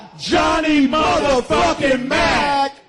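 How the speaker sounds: high, slow shouted announcement; hype-man roll call. Acted. Host announcing the final guest with a big shouted hype call. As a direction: stretch out the last name like a ring announcer.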